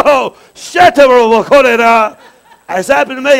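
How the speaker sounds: high, very loud drawn-out cry; sudden fervent outburst. Acted. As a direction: erupt as if something just snapped. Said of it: "something snapped. And you went".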